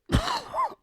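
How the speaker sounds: violent hacking cough; phlegmy cold cough; loud cough. Real, not acted. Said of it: "you can hear it in my voice".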